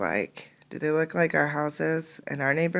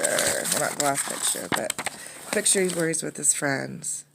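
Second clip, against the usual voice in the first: plain reading voice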